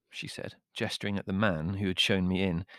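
usual speaking voice

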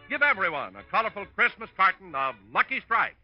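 usual speaking voice

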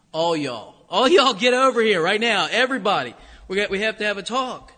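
loud Kentucky call gathering everyone; emphatic Kentucky y'all. Retold or imitated. Kentucky preacher calling the crowd over, emphatic. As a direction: round them all up Kentucky style. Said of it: "in Kentucky ... emphatic".

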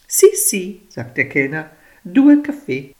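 a hint of an Italian waiter. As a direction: answer breezily in Italian.

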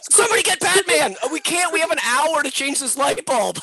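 high, loud mock panic; panicked cop. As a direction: panic over a dead bulb.

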